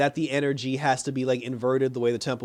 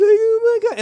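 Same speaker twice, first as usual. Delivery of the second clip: high, loud mock wail; exaggerated sobbing imitation; mock crying; 8 dB louder, slower than usual. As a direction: imitate a crybaby's high-pitched whine. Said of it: "now she's crying".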